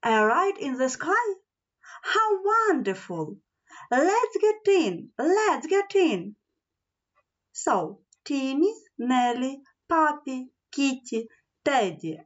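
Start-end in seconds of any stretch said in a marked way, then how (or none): 0.0-6.3 high-pitched storybook delight; lightly acted, eager exclamations; excited cry. A narrator's reading. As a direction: exclaim like eager children, without shouting. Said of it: "everyone cried".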